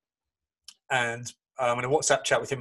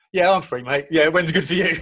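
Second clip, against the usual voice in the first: eager; 5 semitones higher, 7 dB louder than usual. Retold or imitated. Eager reply to football star.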